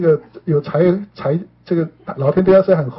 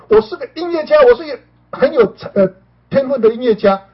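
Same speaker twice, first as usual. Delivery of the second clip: much higher, proclaiming out loud; proud declaration; 8 semitones higher, 3 dB louder than usual. Retold or imitated. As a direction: announce it to the room like a vow. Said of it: "然后最后对大家大声的讲". (高声宣告)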